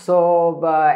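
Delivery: ordinary talk, nothing marked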